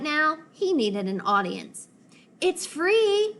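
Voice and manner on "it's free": high, coaxing sales pitch; enticing. Acted. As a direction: tempt her in with it. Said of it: "he said enticingly".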